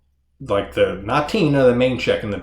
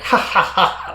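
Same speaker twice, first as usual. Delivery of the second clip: imitated mad villainess cackle; maniacal cackle; 9 semitones higher, 3 dB louder than usual. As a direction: mimic a maniacal burst of cackling. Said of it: "breaks out into this maniacal cackle".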